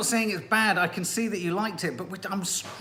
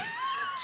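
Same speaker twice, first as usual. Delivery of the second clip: high laugh burst; 9 dB quieter than usual. Real, not acted.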